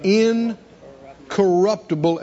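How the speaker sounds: normal talking voice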